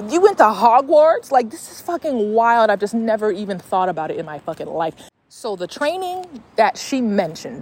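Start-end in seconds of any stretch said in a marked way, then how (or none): 0.1-1.3 incredulous. Real, not acted. Incredulous at week-long medium camp.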